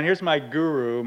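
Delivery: usual speaking voice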